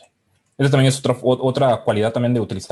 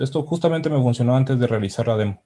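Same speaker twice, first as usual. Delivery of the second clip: normal talking voice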